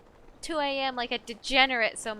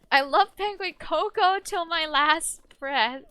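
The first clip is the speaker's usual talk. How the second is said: dramatic devotion; 6 semitones higher, 7 dB louder, slower than usual. Pretend. Reading a devoted fan message.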